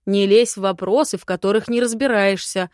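loud, growling rebuke; angry lordly growl; growled warning. A narrator's reading. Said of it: "прорычал лорд".

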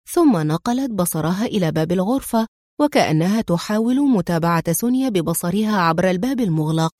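usual speaking voice

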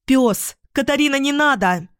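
high, loud warning cry; alarmed shout. A narrator's reading. Тиона shouting to Катарина in the cellar, high alarmed cry. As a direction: cry out sharply to stop someone. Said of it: "закричала девушка".

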